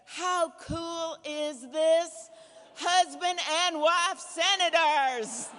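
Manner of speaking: slow, drawn-out delighted gush; gushing flight attendant. Retold or imitated. Flight attendant gushes to the passengers, slow and delighted. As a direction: stretch it like a thrilled flight attendant. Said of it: "the flight attendant then says".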